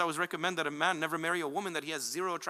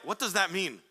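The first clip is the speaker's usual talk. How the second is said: usual speaking voice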